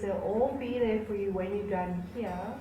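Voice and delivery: ordinary delivery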